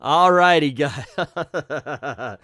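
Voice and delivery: words squeezed out through real laughter; loud laugh carrying the words. Real, not acted. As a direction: still cracking up. Laughing at the comic line.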